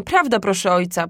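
ordinary delivery

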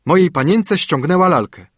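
nanny rudely confronting the mother; loud rude servant accusing; brutal scolding. Acted.

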